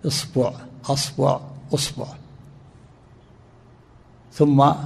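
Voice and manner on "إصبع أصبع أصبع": إصبع، أصبع، أصبع said isbu, asbu, usbu, changing only the first vowel; إصبع، أصبع، أصبع with the opening vowel as kasra, fatha, damma; vowel variants. Shown as a demonstration. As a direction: run through إصبع، أصبع، أصبع as i-, a-, u- before sb. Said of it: "تقول مثلًا".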